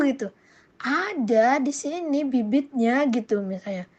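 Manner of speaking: usual speaking voice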